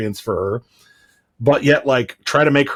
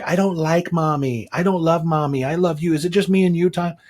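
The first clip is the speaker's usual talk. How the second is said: lightly put-on small child cooing; sweet daughter voice; 7 semitones higher than usual. Father imitating his daughter talking to him, higher child voice. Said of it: "she'll say things like".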